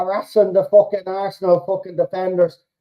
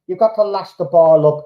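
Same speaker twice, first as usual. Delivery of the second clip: ordinary talk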